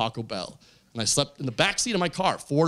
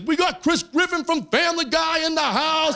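high, hyped-up DJ announcement; club DJ; 12 semitones higher than usual. Retold or imitated. DJ to packed dance floor, high hyped shout-out. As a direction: announce it like a DJ over the speakers. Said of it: "The D J said my name over the speakers".

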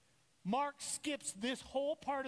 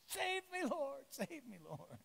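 mock plea; 6 semitones higher, slower than usual. Preacher imitating himself crying out to God, fading plea. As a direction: beg for rescue, trailing off. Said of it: "I feel myself going".

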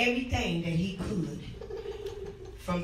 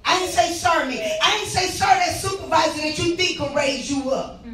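hollering revival preacher; 4 semitones higher, 5 dB louder than usual. Real, not acted. Preacher hollers, near crying.

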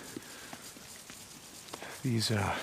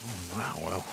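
ordinary talk, nothing marked

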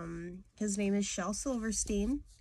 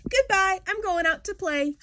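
much higher and louder, gleeful; sudden glee; 11 semitones higher, 9 dB louder than usual. Acted. Girl learning it's Saturday, shouting with joy. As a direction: burst out cheering, cured instantly.

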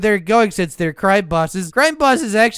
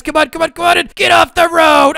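excited chase shout; 7 semitones higher, 4 dB louder than usual. Pretend. Cop ramming the car.

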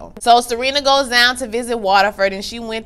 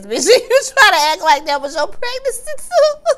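much higher, louder, laughing through it; 4 dB louder than usual. Real, not acted. Viewer mocking Serena, laughing hard throughout. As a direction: cackle while you say it.

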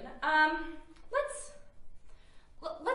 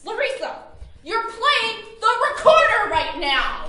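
higher and louder furious yell; angry shouting; 8 semitones higher, 8 dB louder than usual. Acted. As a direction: yell it at your roommate. Quarrel peaks, both shouting.

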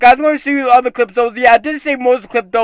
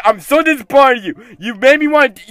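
ordinary talk, nothing marked

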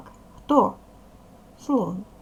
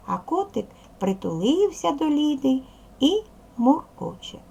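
put-on lively storybook reading for young pupils; expressive reading aloud; 4 semitones higher, 5 dB louder than usual. Shown as a demonstration. Teacher reading a story to children, expressive. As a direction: read the little story brightly to the class.

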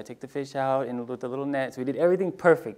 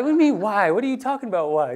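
high, loud exasperated disbelief; emotional outburst; 8 semitones higher, 6 dB louder, faster than usual. Retold or imitated. Dad protesting to his wife Julie, high and loud. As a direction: push back at her, upset and loud. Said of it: "I was being emotional with her".

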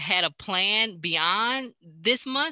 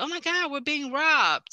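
mock alarm; 12 semitones higher than usual. Open-house visitor spotting the bank robber, mock alarm. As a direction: imitate a startled bystander, voice pitched up high. Retells the viewer's alarm again.

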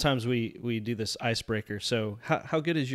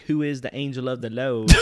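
put-on country accent; 6 dB louder than usual. Host mimicking Betty's country-accent question, lightly drawled. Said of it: "it turned into a country accent".

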